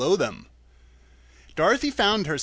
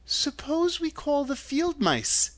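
put-on high, slow girl's voice; a tentative suggestion, pitched high and unhurried; Dorothy; 11 semitones higher, slower than usual. A narrator's reading. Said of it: "she suggested".

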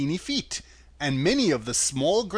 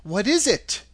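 put-on mildly surprised girl, pitched higher; higher, understated surprise; Dorothy; 6 semitones higher, 4 dB louder than usual. A narrator's reading. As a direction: answer with a touch of surprise. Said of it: "answered Dorothy in surprise".

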